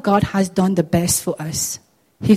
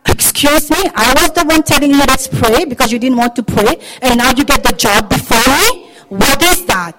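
raised, louder affronted complaint; jealous indignation; 4 semitones higher, 5 dB louder than usual. Acted. Friend who prayed confronting the one who got the job, jealous. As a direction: protest as the one unfairly passed over. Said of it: "That is a spirit of jealousy".

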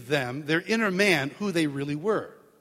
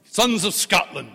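movie speech quote; 2 semitones higher, 5 dB louder, slower than usual. Retold or imitated. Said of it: "does this long speech".

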